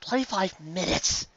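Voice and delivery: drawn-out whine, slow; whining. A narrator's reading. Carrot Top whining to her friends about the long wait. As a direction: whine the wait like it's endless. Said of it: "whined Carrot Top".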